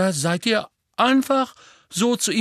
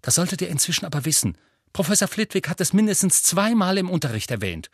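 fast, higher scolding; irritated; 9 semitones higher, faster than usual. Acted. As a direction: rattle it off like an exasperated swot. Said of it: "sagte Hermine gereizt". (gereizt und schnell)

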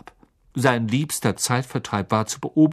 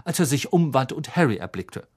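plain reading voice

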